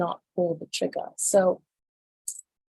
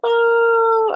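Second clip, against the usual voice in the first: surprised oh; 14 semitones higher, 8 dB louder than usual. Real, not acted. Reacting to shocking headline.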